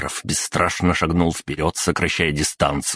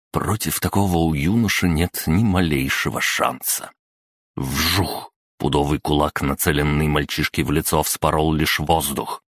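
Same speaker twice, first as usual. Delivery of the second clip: usual speaking voice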